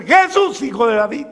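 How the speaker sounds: high, loud heartfelt plea; crying out. Acted. Believer crying out to Jesus with all their heart. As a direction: call out with your whole soul. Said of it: "clamarle con el alma".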